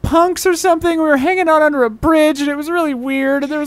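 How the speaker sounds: high, tearful acted confession; crying. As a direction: blurt it out in a rush through mock sobs. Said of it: "immediately starts crying".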